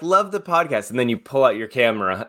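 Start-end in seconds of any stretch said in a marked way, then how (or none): 0.0-0.9 mock eager fan. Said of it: "You're like".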